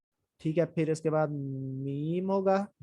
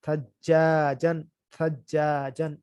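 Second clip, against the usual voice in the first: very slow, each sound drawn out; slow model reading; much slower than usual. Shown as a demonstration. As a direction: model the word slowly for students. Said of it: "جیسے یہاں پر تھا".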